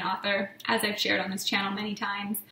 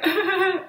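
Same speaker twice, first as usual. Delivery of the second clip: high, loud dry sobbing imitation; mock crying noise; 9 semitones higher, 6 dB louder than usual. Shown as a demonstration. Vlogger showing how they cry, mock sobbing. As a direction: imitate crying with no tears coming. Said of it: "I just start, like".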